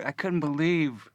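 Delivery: ordinary delivery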